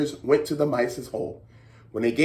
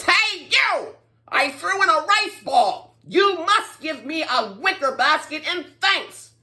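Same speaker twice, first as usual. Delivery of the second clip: put-on high bossy old man voice; demanding shout; 11 semitones higher than usual. Greedy old man shouting demands at the mice. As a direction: demand it pushily. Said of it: "shouted at the mice".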